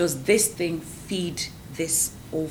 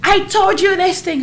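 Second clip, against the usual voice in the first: mock reproach; 12 semitones higher, 9 dB louder than usual. Husband reminding her.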